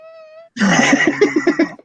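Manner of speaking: shrieking laugh. Real, not acted. Startled by a fly.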